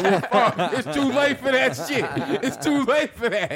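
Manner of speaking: loud, high mock-outraged yell; hammed-up high shouting, near laughing; comic outrage. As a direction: yell back in comic exasperation with laughter around it. Retold outraged reply to late warning.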